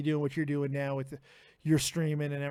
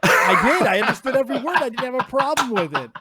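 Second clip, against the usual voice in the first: loud laughing reply, higher; 6 semitones higher, 11 dB louder than usual. Real, not acted. Host laughs loudly while praising the caller's voice. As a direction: laugh out the words.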